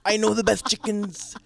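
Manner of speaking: laughing, high and loud Trump voice; loud, laughing mock Trump; Trump impression. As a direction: ham up the Trump bragging. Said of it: "I love doing a Trump accent".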